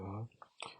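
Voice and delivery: normal talking voice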